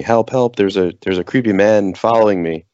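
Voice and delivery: half-screamed alarm, feigning fear; mock frantic scream. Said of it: "frantically screaming".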